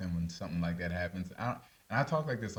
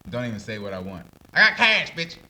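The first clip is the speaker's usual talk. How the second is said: cocky cash boast; 3 semitones higher, 10 dB louder than usual. Retold or imitated. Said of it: "be like".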